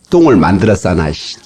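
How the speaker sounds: low grumbled inner complaint; acted muttering, dropping in pitch; imitated grumbling. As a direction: grumble a mild curse to yourself. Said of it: "욕을 막 궁시렁궁시렁 합니다".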